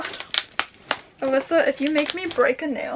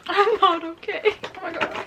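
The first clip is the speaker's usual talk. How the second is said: laughing; 7 semitones higher, 5 dB louder than usual. Real, not acted. Laughing at the gift.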